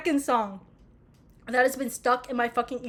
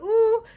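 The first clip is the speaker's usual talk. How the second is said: imitated high singer's ooh; 9 semitones higher, 5 dB louder than usual. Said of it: "a dead man in my ear be like".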